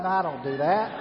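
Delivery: ordinary talk, nothing marked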